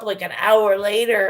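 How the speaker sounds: ordinary talk, nothing marked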